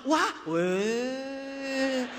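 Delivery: gasped "wah" sinking into long "weh"; deflated crowd. Retold or imitated. Audience learns nobody has the car, drawn-out mock letdown. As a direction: imitate a crowd's excitement collapsing.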